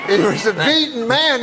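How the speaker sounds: ordinary talk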